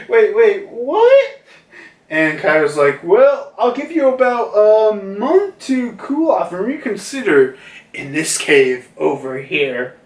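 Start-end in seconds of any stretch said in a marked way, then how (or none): 0.1-1.4 high, loud incredulous squeal; shocked. Acted. Young Yamato hearing Kaido's threat, acted shock. 3.0-10.0 starts high, settling down to usual pitch; lightly acted father's sentence. Kaido sending Yamato to the cave, mock pronouncement.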